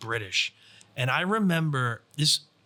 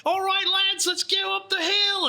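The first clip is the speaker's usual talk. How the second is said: much higher and louder, a hammed-up British battle cry; butchered British accent; 3 dB louder than usual. British player leading the charge up the hill, in a butchered British accent. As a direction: yell it like a British squad leader in a bad accent. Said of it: "just a butchering accent right now".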